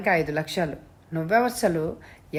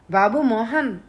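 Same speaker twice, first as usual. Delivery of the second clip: a choked, raised call, voice catching; choked cry; 4 semitones higher, 3 dB louder than usual. A narrator's reading. Father calling to his kidnapped son over the phone, choked cry. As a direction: cry out for the son, throat tight. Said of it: "గాద్గదికమైన కంఠంతో అరిచాడు".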